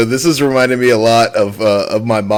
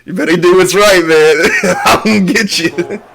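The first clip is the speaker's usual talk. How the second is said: mock stern dad; 7 semitones higher than usual. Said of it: "your dad is just like".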